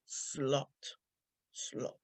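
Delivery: "slot" softly drawn out on a rounded O; careful O in slot. Shown as a demonstration. Speaker practising the O as advised, careful rounded slot. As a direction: say "slot" low and slow, attention on the O. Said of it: "put attention on the O".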